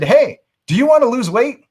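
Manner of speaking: high, loud, blunt salesman's question; pushy sales pitch. Retold or imitated. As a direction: bluntly pitch a stranger in a room. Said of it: "walked up to them and said".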